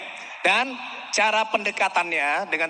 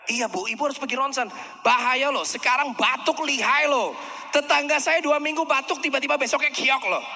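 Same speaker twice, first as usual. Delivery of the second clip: pitched up, pressing alarm about the cough; alarmed warning; 5 semitones higher than usual. Shown as a demonstration. Salesman urging a coughing client, higher and alarmed. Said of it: "semakin Anda ngomong begini".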